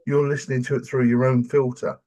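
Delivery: usual speaking voice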